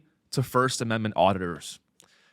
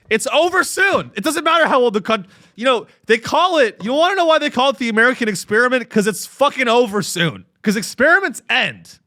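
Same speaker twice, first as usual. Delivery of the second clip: mock doom rant; 8 semitones higher, 3 dB louder than usual. Ranting doom joke.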